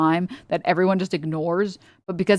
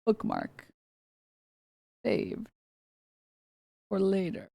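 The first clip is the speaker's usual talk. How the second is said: deadpan aside; 12 dB quieter than usual. Retold or imitated. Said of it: "and I'm like".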